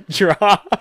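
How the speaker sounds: high, loud, laughing drop call. Pretend. Describing ride ending, laughing mock drop call. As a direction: blurt it out through laughter.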